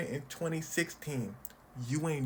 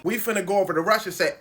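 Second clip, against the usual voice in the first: loud and fast mock Trump; 4 semitones higher, 9 dB louder, much faster than usual. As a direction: rattle it off loudly, fast. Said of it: "Donald Trump is saying".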